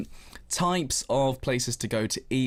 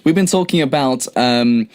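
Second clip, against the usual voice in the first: radio host; 12 dB louder than usual. Acted. Radio host reading on air.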